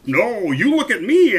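high, loud, delighted king; the king shows off the hat to Bartholomew; gleeful shout. A narrator's reading. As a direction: crow it out proudly.

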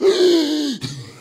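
high, drawn-out comic squeal; demonstrated high noise. Shown as a demonstration. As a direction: act out a state with a squeal. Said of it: "leaving twenty seventeen like this".

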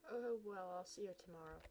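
usual speaking voice

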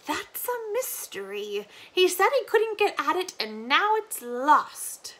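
put-on slow, mysterious old woman; faraway granny. Granny telling Lina the family mystery, faraway. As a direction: drift into memory. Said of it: "with a faraway look".

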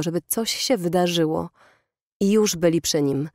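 plain reading voice

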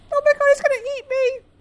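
very high, fast and loud; rapid high-pitched panic; panicked squeal. Real, not acted. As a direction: squeal it out in terror. About to be eaten.

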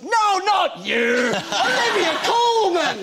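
high, loud, drawn-out mock shout; indignant shout. Old man brushing the actor aside for his co-star, indignant shout. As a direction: bellow the punchline as the impatient old fan.

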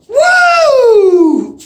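high whooping cheer, full volume; cheer shout. Real, not acted. Fan whooping for his team, loud high cheer. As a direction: let out a big fan whoop. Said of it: "That was number four".

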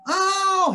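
very high, loud yelp; lion stung inside his ear; pained yelp. Acted. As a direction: yelp in pain.

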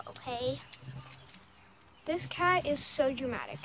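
ordinary delivery

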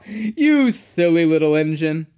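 loud, amused chuckle into the words; laughing. A narrator's reading. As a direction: laugh heartily at the silly engine. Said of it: "laughed his driver".

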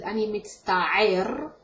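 "ani mitzta'er" slow, in a different Hebrew accent; other Hebrew accent. Shown as a demonstration. Teacher showing another Hebrew accent, slowly. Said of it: "In different accents is".

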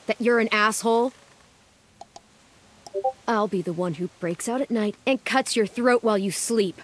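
ordinary delivery

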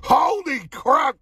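slow, high holy crap; drawn-out amazement. Real, not acted. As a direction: stretch it out in disbelief. Tasting reaction, drawn out.

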